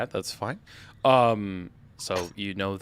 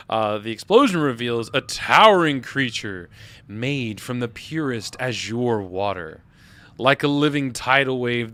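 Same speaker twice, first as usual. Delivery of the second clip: dramatic narration; 2 semitones higher, 6 dB louder than usual. A narrator's reading.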